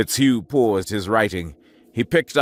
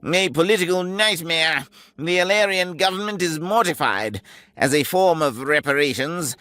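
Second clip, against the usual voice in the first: a briefing officer, higher and curt; the major; 4 semitones higher than usual. Acted. Major briefing the captain, curt and sardonic. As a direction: deliver bad news like a weary senior officer.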